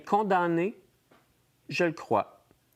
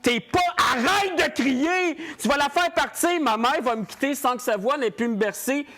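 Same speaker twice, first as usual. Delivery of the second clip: panicked shouting; 5 semitones higher than usual. Acted. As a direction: beg him to stop screaming, rising to a shriek. Demon screams, mother fading. (panique qui monte jusqu'au cri)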